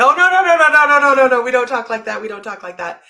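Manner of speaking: loud, high burst of no's, coming down to normal; panicked protest. Retold or imitated. Nail technician hushing client's talk of dying, panicked protest. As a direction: cut them off in hammed-up alarm. Retold horrified reply to client.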